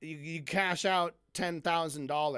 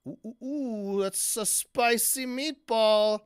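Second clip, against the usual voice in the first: hammed-up spicy meatball; 6 semitones higher, 6 dB louder than usual. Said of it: "reacting to quote".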